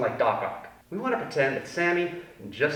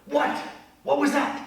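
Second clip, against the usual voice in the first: mildly startled parent; 11 semitones higher than usual. Retold or imitated. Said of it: "If your parents go".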